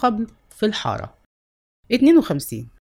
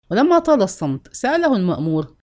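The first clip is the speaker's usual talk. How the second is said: ordinary delivery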